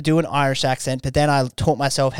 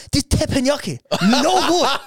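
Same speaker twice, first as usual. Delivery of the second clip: high, louder faked Japanese accent; hammed-up Japanese accent; mock Japanese accent; 12 semitones higher, 4 dB louder than usual. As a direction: ham it up at the sushi train. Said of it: "I just go".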